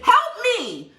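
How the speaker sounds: usual speaking voice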